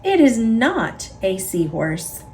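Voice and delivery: ordinary delivery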